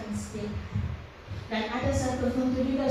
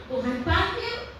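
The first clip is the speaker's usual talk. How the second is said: ordinary talk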